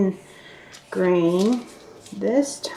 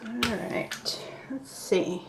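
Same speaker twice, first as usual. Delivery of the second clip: hushed whisper to herself; 2 semitones higher, 10 dB quieter, much slower than usual. Real, not acted. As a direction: murmur under the breath while working. Quiet aside while working dough.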